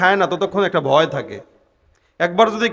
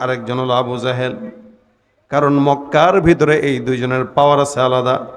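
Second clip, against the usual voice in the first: ordinary talk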